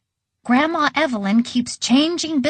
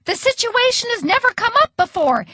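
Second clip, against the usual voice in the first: high-pitched, restrained yell; yelling back; 11 semitones higher than usual. A narrator's reading. Waitress defending herself to Grandma, yelling back. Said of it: "yelled the waitress right back".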